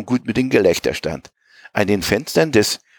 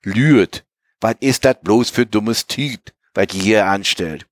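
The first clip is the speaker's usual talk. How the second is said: plain reading voice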